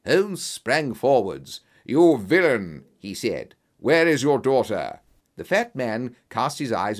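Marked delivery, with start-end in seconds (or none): 1.8-2.8 loud, slow denunciation; angry accusation. Acted. Detective confronts the villain. 3.8-5.0 loud, forceful interrogation; stern, raised-voice challenge; angry demand. Acted. Detective confronts the villain.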